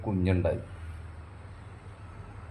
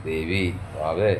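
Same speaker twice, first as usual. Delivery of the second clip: tender coaxing; 4 semitones lower, much slower than usual. Acted. Husband sweetly calling his sulking wife by pet names, tender. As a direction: melt and coax her gently.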